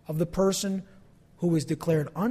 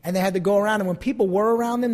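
ordinary talk, nothing marked

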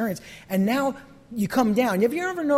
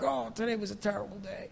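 much softer, droopy moan; mock gloomy; 11 dB quieter than usual. Worker home from a bad day, mock gloomy. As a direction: sag under the bad day. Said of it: "You have a terrible day at work".

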